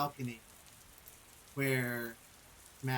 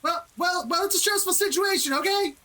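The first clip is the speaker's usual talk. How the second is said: much higher, louder whiny protest; high defensive goon voice; 9 dB louder than usual. Acted. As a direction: squeal back defensively. Enemy retorts to taunt.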